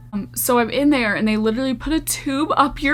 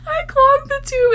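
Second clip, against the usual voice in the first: much higher, voice squeaking with laughter; laughing high read; 4 dB louder than usual. Real, not acted. Reading a viewer's story aloud, high laughing voice. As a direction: read the line through laughter.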